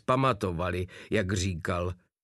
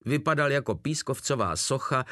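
usual speaking voice

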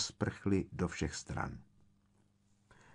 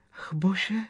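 high, gasped little yelp; frightened shriek; 10 semitones higher than usual. A narrator's reading. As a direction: gasp it out, pitched way up. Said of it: "vyjekla Hermiona".

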